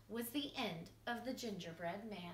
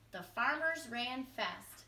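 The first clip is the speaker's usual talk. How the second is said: usual speaking voice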